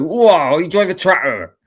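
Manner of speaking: loud rural exclamation; farmer voice. Pretend. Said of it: "you come on here and go".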